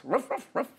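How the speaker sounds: high playful woof woof; imitated barking. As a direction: bark it out like a dog. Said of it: "dog barks".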